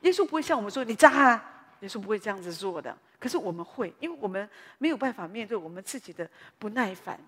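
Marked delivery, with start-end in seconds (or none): 0.8-1.6 sudden loud mock shout; angry retort. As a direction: snap it out loud like a quarrel. Mocking how we quarrel. (大声呛人)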